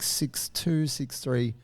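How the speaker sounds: ordinary delivery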